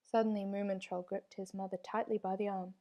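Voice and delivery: usual speaking voice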